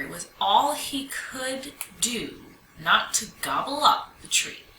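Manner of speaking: usual speaking voice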